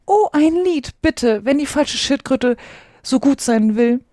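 high-pitched, eager outburst at the start. A narrator's reading. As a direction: plead keenly for a treat. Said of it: "antwortete Alice mit solchem Eifer".

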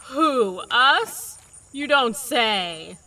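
exaggerated, drawn-out sarcastic disbelief. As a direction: feign surprise with heavy sarcasm. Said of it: "I detected the sarcasm that time".

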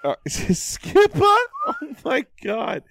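high, slow laughing squeal; laughing disbelief. Real, not acted. [name] laughing at Paul Revere's voice, squealing high. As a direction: crack up in disbelief. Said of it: "laughing at the voice".

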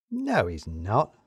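slow, drawn-out denial; disbelief. A narrator's reading. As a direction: refuse to believe it, drawing the words out. Acted disbelief in dialogue.

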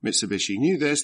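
usual speaking voice

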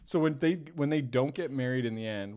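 normal talking voice

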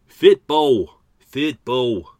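broad Scottish "fitba", loud then easing off; Scottish accent. Pretend. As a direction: say it like a hammed-up Scot, punched out. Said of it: "Can you believe I've got Scottish relatives?".

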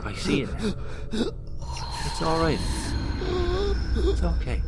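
agonised shrieks then forced calm; screaming in pain. Acted. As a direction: scream as you are attacked.

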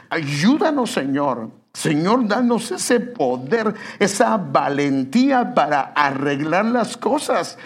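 slow pleading prayer to the Lord; earnest prayer. Acted. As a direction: pray it earnestly for the congregation. Leads closing prayer. (oración sincera y suplicante)

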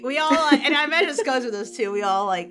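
usual speaking voice

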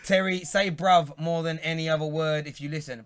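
ordinary talk, nothing marked

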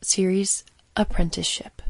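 hushed announcer whisper; whispered title. Acted. Host whispering the series title to listeners. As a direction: breathe the series name like a secret.

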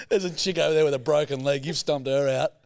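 ordinary talk

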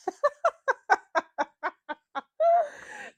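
a burst of high, loud laughter. Real, not acted. Host cracking up at James's prank call, loud high laugh.

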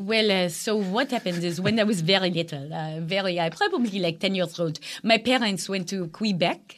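put-on French accent. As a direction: answer in a playful French accent. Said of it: "I am not really French".

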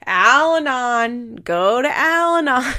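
hammed-up plea to go to Al-Anon; doctor loudly pushing the family toward Al-Anon; exaggerated mock urging. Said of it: "Doctor Drew is like".

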